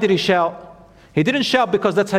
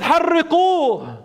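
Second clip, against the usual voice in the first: priest's call-out; 11 semitones higher than usual. Retold or imitated. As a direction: imitate a priest crying out an order. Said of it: "One priest called out".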